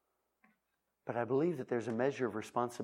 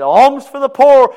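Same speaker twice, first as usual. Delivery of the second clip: high, loud, slow wailing beggar's cry; loud drawn-out beggar calling for alms; beggar's plea; 13 semitones higher, 21 dB louder, slower than usual. Acted. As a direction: plead like a beggar at the gate. Beggar voiced in story.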